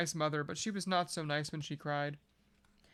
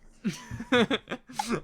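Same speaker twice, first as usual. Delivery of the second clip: a burst of real laughter, higher; 6 semitones higher, 7 dB louder than usual. Real, not acted. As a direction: laugh out at the friend's joke. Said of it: "Yeah, you should be listening to house music".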